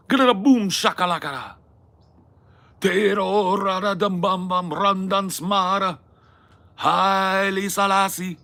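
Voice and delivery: high, loud mock Jamaican accent. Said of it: "Her Jamaican accent was my favorite Harris accent".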